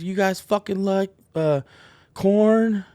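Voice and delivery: high, loud stage shout; crowd call. Retold or imitated. [name] calls out to the crowd, high stage shout. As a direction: yell it out to a crowd. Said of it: "he goes".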